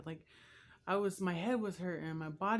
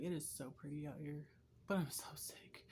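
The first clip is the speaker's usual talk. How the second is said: hushed; 2 semitones lower, 8 dB quieter than usual. Retold or imitated. Quoting herself sick on pretty day.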